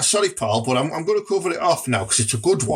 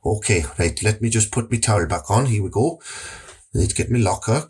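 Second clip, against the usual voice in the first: low dodgy dealer; 6 semitones lower than usual. Acted. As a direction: lower it like a shady gym dealer.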